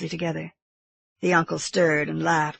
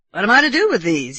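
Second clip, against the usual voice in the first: louder, higher excited question; exclaiming uncle; 4 semitones higher, 7 dB louder than usual. A narrator's reading. Uncle waving winnings at nephew, exclaiming. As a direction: cry it out to the boy. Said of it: "he cried".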